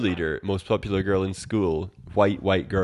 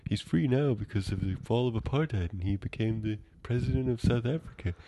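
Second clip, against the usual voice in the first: rambling stoner lecturer impression; Adam Sandler; 3 semitones higher, 4 dB quieter than usual. Actor's character gives a history lecture, goofy stoner impression. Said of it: "He's like".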